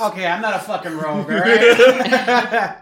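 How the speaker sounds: laughing protest. Real, not acted.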